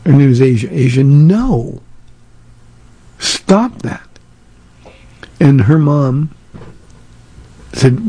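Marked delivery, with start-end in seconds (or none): 0.7-4.1 put-on a firm warning to a screaming toddler, slowed down; stern grandpa command. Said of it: "I said".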